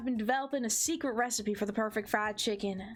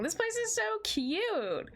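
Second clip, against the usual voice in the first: gushing; 11 semitones higher, slower than usual. Real, not acted. Gushing over the cafeteria.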